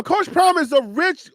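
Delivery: ordinary talk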